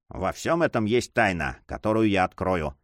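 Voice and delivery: ordinary delivery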